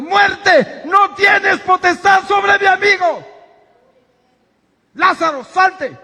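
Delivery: high, loud, slow command against death; defiant shout. Retold or imitated. Jesus shouting at death over his friend, high defiant roar. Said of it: "Estaba gritando".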